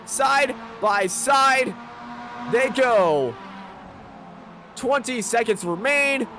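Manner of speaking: rising, frantic play-by-play shout; excited race call. Real, not acted. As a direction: call the finish at full voice.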